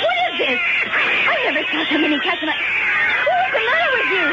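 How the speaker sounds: high, fast frightened outburst; alarmed. Acted. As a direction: panic rising as cats close in. Woman surrounded by cats.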